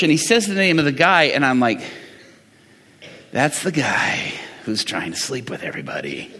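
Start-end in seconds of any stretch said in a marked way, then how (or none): 3.3-6.3 very low, hushed aside; muted, low disbelief under your breath; stunned realisation. Retold or imitated. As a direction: drop to a quiet private thought. Retold stunned private realisation of dad.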